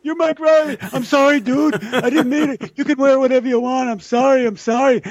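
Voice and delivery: high, flustered mock apology; shocked apology. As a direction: blurt it out high like a starstruck, mortified rider. Retold rider's shocked, flustered apology.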